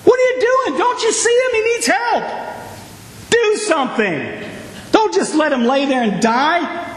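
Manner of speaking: shouting at the screen for him to help; mock yell at passerby. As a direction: call across the road at the man walking away. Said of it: "And we might yell".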